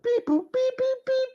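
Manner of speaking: very high beeping noises; robotic beeps. Pretend. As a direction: beep like a machine. Imitating a phone noise.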